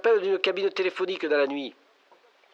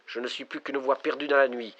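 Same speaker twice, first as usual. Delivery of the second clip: normal talking voice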